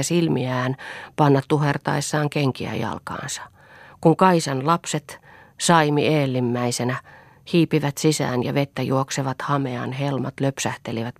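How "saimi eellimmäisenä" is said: usual speaking voice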